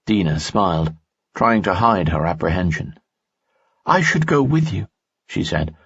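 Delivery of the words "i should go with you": higher young woman's voice, lightly voiced; worried young woman, pitched up; Dina's voice. Dina speaks in story.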